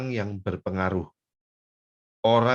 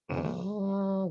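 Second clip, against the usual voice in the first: cat hiss; 12 semitones higher than usual. Pretend. Cat snarling at rival.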